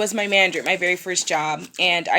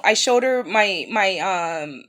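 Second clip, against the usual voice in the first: ordinary delivery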